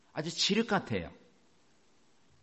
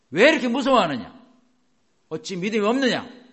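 loud, higher stern scolding; stern rebuke; 8 semitones higher, 9 dB louder than usual. Acted. Jesus sternly rebuking his frightened disciples, loud and high. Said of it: "정색을 하고".

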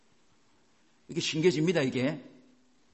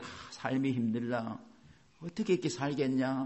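weary despair; 5 dB quieter than usual. Acted. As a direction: drag it out like someone worn down by life. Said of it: "힘이 들은다는 생각이 올 때".

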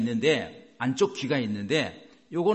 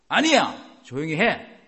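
firm rebuke; 6 dB louder, slower than usual. Acted. Preacher showing congregation how to answer a bad mood, firm rebuke. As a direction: silence a bad mood with force.

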